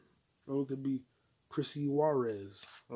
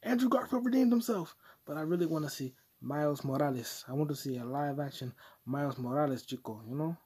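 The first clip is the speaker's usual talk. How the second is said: normal talking voice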